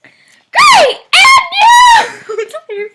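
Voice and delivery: over-the-top excitement; hammed-up Naruto.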